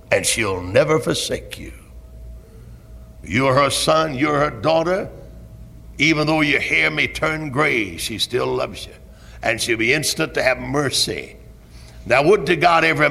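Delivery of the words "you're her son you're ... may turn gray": normal talking voice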